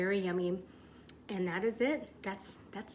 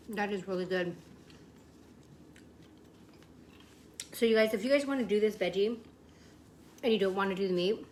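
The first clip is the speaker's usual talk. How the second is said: talking through a mouthful; words around a bite of taco; mouth full; 6 dB louder than usual. Real, not acted. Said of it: "so I'm talking with my mouth full".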